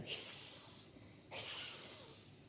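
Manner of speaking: long, soft drawn-out hiss; snake hiss. Shown as a demonstration. Reader showing children a boa constrictor, hissing. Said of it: "it hisses".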